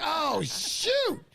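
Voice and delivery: high, hammed-up embarrassed oh shoot; mock embarrassed. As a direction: play the sheepish realization big and high. Retold embarrassed reaction, high.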